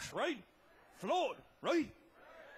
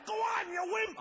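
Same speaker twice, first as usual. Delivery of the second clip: taunting yell; 7 semitones higher, 3 dB louder than usual. Retold or imitated. Brother taunting over wind.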